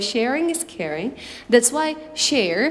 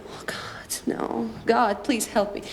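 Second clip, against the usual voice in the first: mock despair; 2 semitones lower, 7 dB quieter than usual. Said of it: "And I was like".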